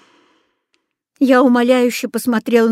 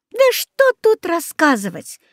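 ordinary delivery, nothing marked

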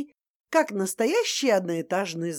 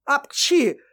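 чих; 4 semitones higher, 4 dB louder than usual. Acted. Чих свекрови.

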